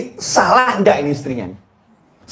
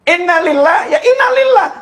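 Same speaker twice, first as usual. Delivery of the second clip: fervent innalillah; 11 semitones higher than usual. Shown as a demonstration. Preacher demonstrates proper innalillah.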